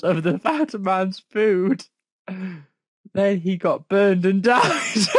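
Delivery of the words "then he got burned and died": laughing out loud. Real, not acted. Reading absurd death, bursting out laughing.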